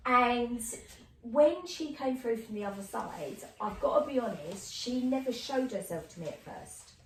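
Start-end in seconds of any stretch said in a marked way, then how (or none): none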